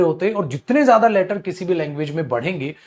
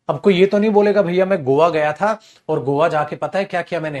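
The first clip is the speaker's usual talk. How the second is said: ordinary delivery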